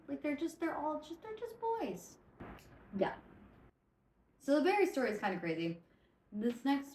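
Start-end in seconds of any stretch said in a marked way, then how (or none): 0.1-2.2 climbing ever higher. Real, not acted. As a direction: let the voice squeak up. Continues high-voiced joke.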